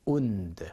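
"und" with a long oo vowel; wound as "oond". Shown as a demonstration. Teacher models the word for injury, "und" as oond. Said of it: "발음 다시 한번 제가 해드릴게요".

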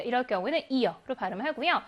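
ordinary delivery

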